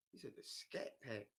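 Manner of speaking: hushed, unvoiced aside; whisper. Real, not acted. Repeating a friend's word to himself in a whisper. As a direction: say it under your breath, puzzled. Repeating an unknown car name.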